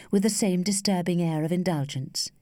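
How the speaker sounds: ordinary delivery, nothing marked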